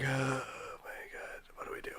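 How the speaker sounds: slow, soft, hopeless murmur; overwhelmed kid. Retold or imitated. Retelling her younger self overwhelmed at Disneyland, slow and soft. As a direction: sigh it out, at a loss. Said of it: "I'm just like".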